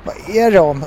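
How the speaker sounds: ordinary delivery, nothing marked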